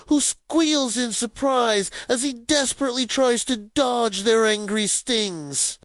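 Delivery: ordinary delivery, nothing marked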